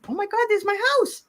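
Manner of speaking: high-pitched startled cry, acted out; mock-startled, squealed high and quick. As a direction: gasp it out fast, as if suddenly recognizing the place. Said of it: "you probably were startled because".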